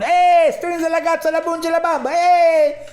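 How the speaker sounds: very high, loud mock-Italian yelling; mock Italian shouting. Italian drivers in a traffic jam shout in mock Italian. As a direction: yell mock Italian gibberish like angry drivers. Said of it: "everyone's leaning outside going".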